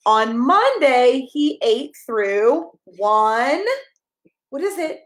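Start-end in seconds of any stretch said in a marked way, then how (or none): none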